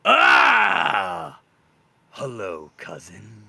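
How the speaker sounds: loud cry, then a low, sly hello; snake cousin greeting. Acted. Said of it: "He goes".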